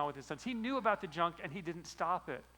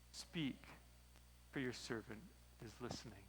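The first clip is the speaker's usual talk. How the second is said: much softer, humble reply; hushed; 5 semitones lower, 10 dB quieter than usual. Retold or imitated. Samuel answering God's call in the dark, hushed. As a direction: speak it low and reverent. Boy answers God at night.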